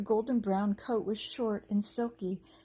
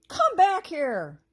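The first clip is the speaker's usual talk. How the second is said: loud, high, slow goblin roar; 9 semitones higher, 9 dB louder, much slower than usual. A narrator's reading. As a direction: roar after the fleeing squirrel. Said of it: "the other one roared".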